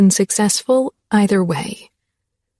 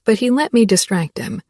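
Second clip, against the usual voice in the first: ordinary delivery, nothing marked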